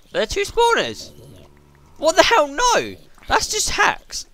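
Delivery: high, loud disbelieving cry; real shocked outburst; shocked outcry. Real, not acted. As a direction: yelp in disbelief at the game. Spawners appear in game.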